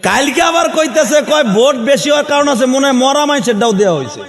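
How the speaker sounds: high, forceful sermon delivery; high-pitched oratory to a crowd; impassioned preaching. Real, not acted.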